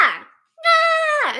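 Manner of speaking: loud, high goat-like bleat; yelling cat imitation. Shown as a demonstration. Showing viewers how the cat yells, bleating. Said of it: "that sounds like this".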